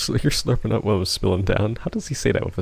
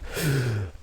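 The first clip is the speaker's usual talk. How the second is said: sharp gasp; 3 semitones higher than usual. Shown as a demonstration. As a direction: gasp in a breath to show it. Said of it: "breathing like crazy".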